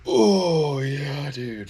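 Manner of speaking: high, loud cry of delight; ecstatic cry. Real, not acted. Thrilled his hero messaged him, high excited cry. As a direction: let out a thrilled yelp. Reacting to hero's DM.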